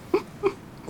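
breathless laugh into words. Real, not acted. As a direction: laugh through the start. Laughing while talking.

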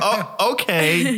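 high, slow, taken aback; drawn-out surprised okay, caught off guard; startled surprise. Retold or imitated. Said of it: "And I'm like".

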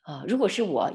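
ordinary delivery

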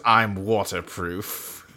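low, slow, soft deadpan; mock cold shrug of a line; deadpan Six. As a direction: drop it flat and unbothered, slowly. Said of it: "He goes".

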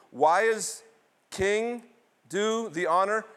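ordinary talk, nothing marked

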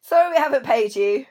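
ordinary talk, nothing marked

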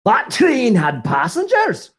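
slow, high, shouted quip; loud joke line. Acted. Co-host shouting a punchline to the others. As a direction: bellow it like a punchline.

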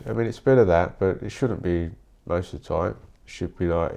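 usual speaking voice

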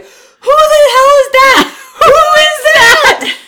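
high, loud, drawn-out panicked shout; freaked-out yell. Retold or imitated. Podcaster acting out Sam seeing his reflection, loud panicked shout. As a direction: scream at your own reflection. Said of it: "he freaks out and he's like".

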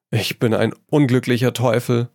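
ordinary delivery

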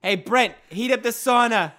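calling out high and loud in a silly voice; loud put-on voice. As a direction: holler to Brent like a goofy sauna guest. Mock sauna customer calling out.

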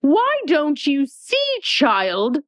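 high, slow, haughty queen; imperious Red Queen. Acted. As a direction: talk down to the child slowly.

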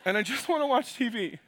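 tired husband whining with a laugh; fed-up grumble about the guests; weary Joseph. Acted. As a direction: Joseph wanting the guests gone. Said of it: "Joseph was like".

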